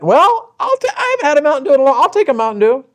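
high-pitched imitation of a delighted pastor; eager pastor. Pastor accepting the old lady's drink offer, high and eager. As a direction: pipe it up like a tempted guest.

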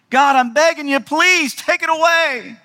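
plead, stretching please; begging. Acted. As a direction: beg God desperately. Acted begging prayer.

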